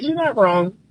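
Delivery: ordinary talk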